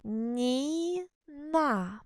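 drawn-out, syllable-by-syllable read; reading the name slowly. Shown as a demonstration. Teacher sounding out a name for first graders, drawn out. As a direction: sound out each syllable for beginners. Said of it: "Читаємо слово".

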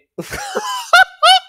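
high, loud burst of laughing; loud, high-pitched giggling fit; real laughter. Real, not acted. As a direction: crack up helplessly. Reader cracks up at the story.

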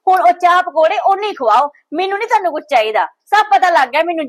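high, loud, bitter tirade; furious accusation. Acted. Daughter accuses her brother's wife of turning mother, furious. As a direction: hurl the blame at her.

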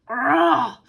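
short barking growl; a quick growl, loud and sharp; dog growl. Acted. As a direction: snarl like an attacking dog. Dog attack in game.

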